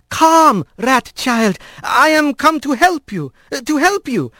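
high, careful calling voice; loud and clear. Acted. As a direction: call out slowly and clearly. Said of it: "as loudly and clearly as he could".

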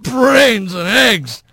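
slow, high-pitched growling oath; strained, angry exclamation; snarled curse. A narrator's reading. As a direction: drag out the curse in frustration. Snarling Bobcat curses as he collapses.

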